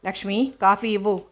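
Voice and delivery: mild shout to the maid; calling out. A narrator's reading. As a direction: call the maid across the house. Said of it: "అని కేకేసింది".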